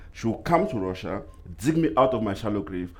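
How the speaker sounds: ordinary talk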